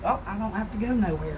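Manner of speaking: ordinary talk, nothing marked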